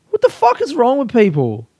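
high-pitched comic indignation; exasperated rant. Acted. Comedian berating shops closed on Easter, exasperated rant.

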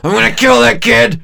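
high, loud hammed-up yell; over-the-top shouted threat; mock furious shout. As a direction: explode in pretend rage. Voicing the patient's outburst.

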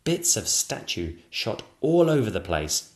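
usual speaking voice

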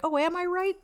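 high mock-innocent "am I right"; sarcastic. Mother mocking the paramedics, sarcastic high squeak. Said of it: "And I'm like".

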